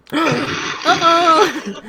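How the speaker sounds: high, loud gasping uh-oh; hammed-up shocked gasp; mock alarm. Real, not acted. GM reacts to threat joke.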